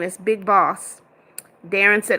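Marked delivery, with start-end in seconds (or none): 0.0-1.0 slow, firm stress on Boss; insisting on the right name, slow and firm; correcting the name. Real, not acted. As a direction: lean on Boss, slowly and deliberately. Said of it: "It's not Big Man".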